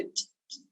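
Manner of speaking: "t" as a voiceless tongue tap, no vowel; sharp T sound. Shown as a demonstration. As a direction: tick out "t" sharply from the ridge behind the teeth. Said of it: "that's where you get a nice sharp".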